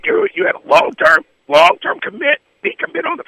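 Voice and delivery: cracking high in anger; heated high-pitched shout. Real, not acted. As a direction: voice jumps high, arguing. Heated radio argument.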